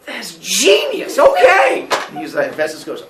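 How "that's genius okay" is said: slow, loud, then a very high shouted okay; excited shout. Acted. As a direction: yell it, thrilled and reckless. Zeus thrilled by axe plan.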